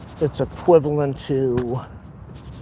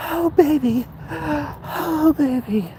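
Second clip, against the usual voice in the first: breathless; 13 semitones higher, much slower than usual. Real, not acted. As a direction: gasp it out, winded from the hill. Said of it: "you can hear my breath now".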